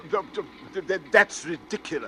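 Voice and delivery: stammering, rattled protest; nervous stammer. Acted. Passenger unnerved by the stranger's talk, stammering. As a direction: stutter out the denial.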